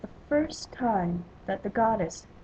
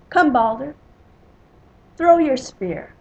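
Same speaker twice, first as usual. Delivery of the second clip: loud, raised challenge; calling out; 4 semitones higher, 10 dB louder than usual. A narrator's reading. Telling the warrior to throw his spear, calling out. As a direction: call the dare across the hall. Balder's challenge called out in the hall.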